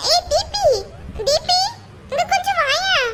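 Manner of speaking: high, carrying call across to a friend. Acted.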